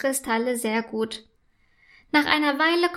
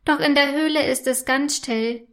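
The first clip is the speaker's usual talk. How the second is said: usual speaking voice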